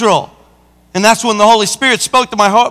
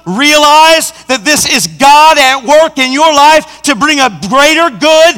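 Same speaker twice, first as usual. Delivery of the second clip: high, insistent pulpit proclamation; impassioned sermon delivery; full-force preaching; 4 semitones higher, 3 dB louder than usual. Acted. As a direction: drive each point home with force.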